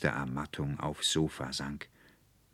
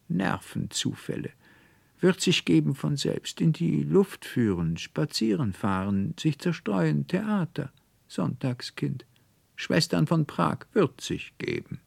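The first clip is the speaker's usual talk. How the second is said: much higher doctor voice rattling off advice; a doctor tossing remarks while leaving; brisk doctor; 11 semitones higher, 3 dB louder than usual. Acted.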